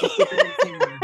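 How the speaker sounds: laughing. Real, not acted. Laughing through the reassurance.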